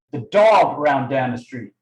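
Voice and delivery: "dog" punched loud, then falling away; stress on DOG. Shown as a demonstration. Coach demonstrating tonality to trainees, stress on "dog". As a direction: lean hard on the word "dog". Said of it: "emphasis on the dog".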